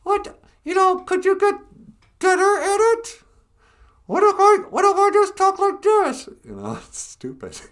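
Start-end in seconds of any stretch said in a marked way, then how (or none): 0.0-6.3 very high, loud squeaky dummy voice; mocking puppet voice. Mocking how ventriloquist dummies talk, very high squeak. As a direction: ham up a bad dummy. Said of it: "looking right at their lips going".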